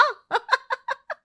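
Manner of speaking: high giggling laugh; teasing laugh. Acted. Friend mocks four hours of cooking, teasing laugh.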